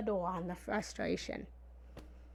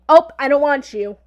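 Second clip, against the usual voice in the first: high, sudden loud refusal; loud rejection; 5 semitones higher, 17 dB louder than usual. Acted. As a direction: turn away an unwanted caller, loudly. Acting out rejecting frustration at door.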